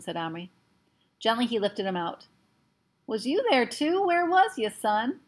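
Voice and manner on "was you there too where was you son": higher, a hint of a rough cowboy; lightly voiced cowboy asking; old cowboy. Boone asking Omri, lightly voiced cowboy.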